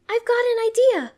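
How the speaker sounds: very high, loud exclamation; calling back. A narrator's reading. Serena hitting on a rescue plan, calling back. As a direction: shout it with sudden eagerness. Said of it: "Serena calls back".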